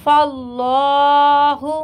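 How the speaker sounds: फल्लाहु with a full, heavy lam, slow recitation; tajweed demo, फल्लाहु with heavy lam. Shown as a demonstration. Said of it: "यहाँ पे भी पूर पढ़ेंगे".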